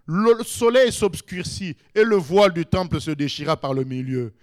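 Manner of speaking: ordinary talk, nothing marked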